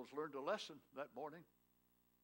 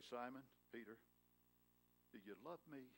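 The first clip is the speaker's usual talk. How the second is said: soft, gentle question, much quieter; tender; 3 semitones lower, 9 dB quieter, faster than usual. Acted. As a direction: ask it tenderly, almost hushed. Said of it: "to look at Simon Peter tenderly".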